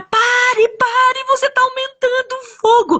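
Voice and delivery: very high, urgent cry to stop; acted warning shout. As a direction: call out in alarm, holding the pitch up high. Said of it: "começou a gritar".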